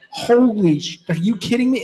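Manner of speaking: half-acted amazement; retold shock. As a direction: replay the disbelief of seeing the goal go in.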